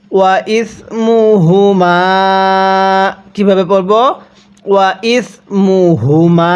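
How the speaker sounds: "ইসমুহুমা" slow, with the ha sounds voiced in the throat; throaty ha. Shown as a demonstration. Teacher shows the round ha voiced from the throat. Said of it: "গোল হাগুলো উচ্চারণ করার সময় গলার মধ্যে একটু আওয়াজ".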